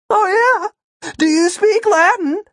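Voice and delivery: very high, loud mocking squeal; taunting. A narrator's reading. Kid challenging the agent about Latin, taunting.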